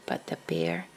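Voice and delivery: hushed whisper so the bear sleeps; soft storyteller whisper; whispered refrain. A narrator's reading. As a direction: whisper it like a secret.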